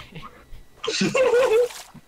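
bursting out laughing loud and high. Real, not acted. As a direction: let out a loud squealing laugh. Can't climb up.

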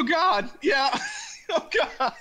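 high laughing yell; laughing shout. Real, not acted. Run over by a buggy.

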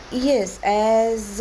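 usual speaking voice

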